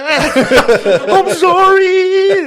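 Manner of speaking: high, hammed-up teary sorry with a laugh; mock crying woman. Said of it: "They start crying".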